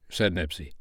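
usual speaking voice

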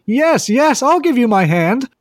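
high-pitched, eager acted yes; emphatic reply. Patient answering her doctor's request for her hand, emphatic imitation. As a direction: imitate an over-keen patient, pitched well up. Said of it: "Lucy emphatically replied".

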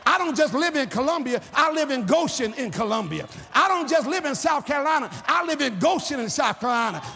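rising, shouted gospel cadence over the organ; full-voiced call of the sermon climax; preaching at full force. Preaching builds with music.

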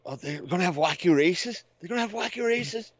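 high, excited cartoon voice; South Park impression. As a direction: squeal it like an over-eager cartoon viewer. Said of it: "Just like that South Park episode".

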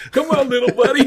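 high squeaky mock voice of a little officer; mock tiny cop. Mocking the short officer's high squeaky voice. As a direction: squeak it like a pint-sized cop.

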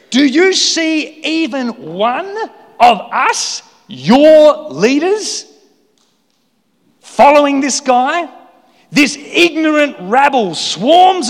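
high, loud, sneering mockery, drawn out on some words; mocking Pharisees. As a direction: look down your nose at the rabble and sneer. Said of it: "They mocked".

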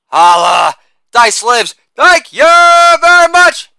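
Holla barked loud, then thank you very much stretched slow and very high; shouted catchphrase. Acted. Comic to audience, hammed-up sign-off, loud high drawn-out shout. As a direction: belt the sign-off like a showman milking applause.

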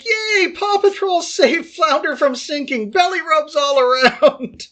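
gushing cartoon fan. Groggy on pain meds, gushing to Nick about Paw Patrol, high giddy squeal. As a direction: cheer it like a giddy kid watching cartoons. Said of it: "And it's like".

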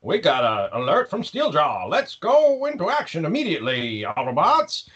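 rally the team like a cartoon hero; hammed-up action-show voice; gung-ho Autobot team. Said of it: "Elita's team is like".

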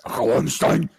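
growled, drawn-out German metal vocal; mock industrial-metal growl. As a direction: growl it slowly like a metal frontman. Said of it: "from the Rammstein song".

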